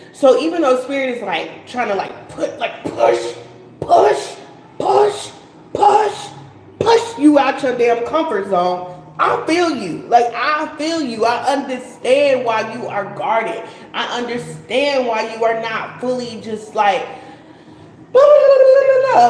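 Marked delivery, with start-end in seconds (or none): 3.8-6.3 each "push" higher and louder; emphatic repeated push. Real, not acted. Urging viewer out of comfort zone. 18.1-19.3 much higher and louder, laughing, then fast; mocking blah blah. Dismissive filler mocking openness.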